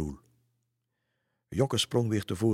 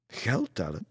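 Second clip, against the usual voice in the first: mildly incredulous, raised pitch; exclaimed question; 4 semitones higher than usual. A narrator's reading. Lisse reacts to Roel's story, exclaiming in question. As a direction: call it out as a surprised question. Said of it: "riep Lisse uit".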